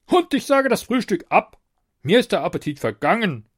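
high, loud petulant king; sulking tyrant king. A narrator's reading. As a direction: sulk like a spoiled monarch. King voiced by narrator.

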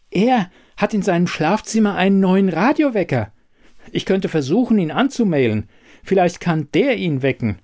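höher gestimmte Kühlschrankstimme, eifrig; Kühlschrank. A narrator's reading. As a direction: ein hilfsbereiter Kühlschrank mit einer Idee. Kühlschrank spricht im Hörspiel. (Kühlschrankfigur, höher und lebhaft)

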